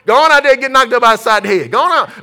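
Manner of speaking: exasperated parent act; mock dismissive parent. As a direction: wave them off loudly, in jest. Acts parent sending child off.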